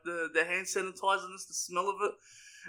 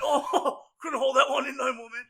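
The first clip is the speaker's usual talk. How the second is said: higher, laughing partner voice; sheepish partner; 6 semitones higher, 4 dB louder than usual. Retold or imitated. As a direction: own up to the fart, laughing. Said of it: "He went".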